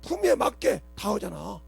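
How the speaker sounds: 높고 크게 회중을 다그치며; 교인들을 꾸짖는 뜨거운 설교; fiery preaching. Acted. (꾸짖는 설교 톤)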